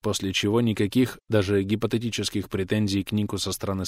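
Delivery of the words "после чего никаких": plain reading voice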